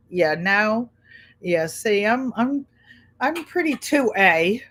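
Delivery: normal talking voice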